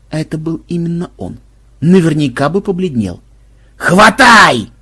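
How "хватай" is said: loud, raised-pitch command; barked order. A narrator's reading. Банзай ordering the team to seize the robber, barking. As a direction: bark it out as an urgent order. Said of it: "рявкнул Банзай".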